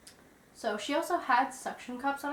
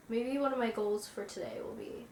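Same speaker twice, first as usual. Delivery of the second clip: usual speaking voice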